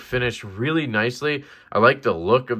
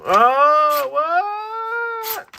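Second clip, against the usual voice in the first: surprised. Real, not acted. Cut surprises him mid-test.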